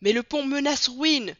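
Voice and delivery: plus aigu et plus fort, inquiet; le conducteur proteste, alarmé; alarme. A narrator's reading. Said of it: "reprit le conducteur". (cri d'alarme)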